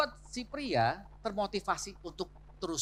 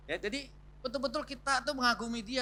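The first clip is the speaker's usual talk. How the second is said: ordinary talk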